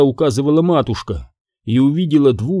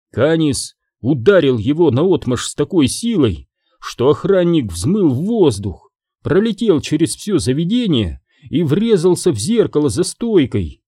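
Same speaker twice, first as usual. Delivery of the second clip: usual speaking voice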